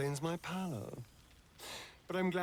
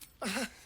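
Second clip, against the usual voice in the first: light laugh; 6 semitones higher than usual. Acted. Astarion's amused reaction.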